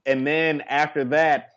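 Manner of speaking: ordinary talk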